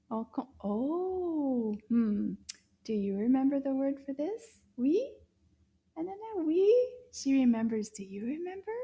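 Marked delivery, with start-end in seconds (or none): none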